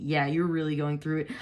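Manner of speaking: ordinary talk, nothing marked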